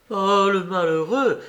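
dismayed outcry; exclaiming. A narrator's reading. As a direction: cry out in dismay. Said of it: "s'écria madame Boulanger".

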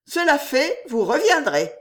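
high-pitched, impertinent lecture; mocking, high-pitched hauteur; haughty dismissal. A narrator's reading. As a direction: send him off to learn manners. Jeanne mockingly dismisses the hunter.

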